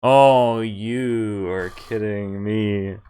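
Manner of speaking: a loud oh, then a slow drawn-out groan; disbelief. Real, not acted. Player finding the magazine gone, slow disbelief. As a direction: let it sink in, dragging the words. Finds magazine already taken.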